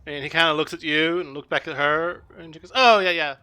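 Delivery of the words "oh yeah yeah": acted eager agreement. GM voices Josette answering orc.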